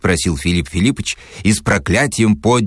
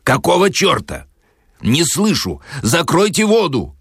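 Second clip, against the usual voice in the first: high-pitched, irritated call over noise; contained theatrical yell; acted shouting; 8 semitones higher than usual. A narrator's reading. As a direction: call out impatiently, pitched well up. Shouting over running water.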